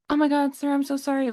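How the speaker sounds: high frantic apology, lightly voiced; high-pitched flustered apology; mock panicked girl. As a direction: plead sorry in a high flustered voice. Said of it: "like, in Spanish".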